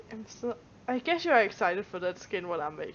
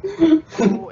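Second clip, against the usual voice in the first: loud exclamation; 6 semitones higher, 14 dB louder than usual. Real, not acted.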